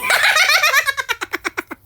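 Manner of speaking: sudden high shrieking laugh; loud burst of laughter. Real, not acted. Told neighbour thinks she found a body, loud incredulous laugh. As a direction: howl with disbelieving laughter.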